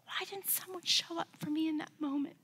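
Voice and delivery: hushed inner whisper; whispered regret to herself. Real, not acted. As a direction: breathe it out as a private thought. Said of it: "when I think back now, I think".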